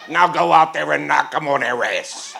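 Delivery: loud high gruff order, laughing; Podell impression. Club owner orders the singer onstage, loud. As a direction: send the kid out like a tough boss. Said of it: "Podell turns to me".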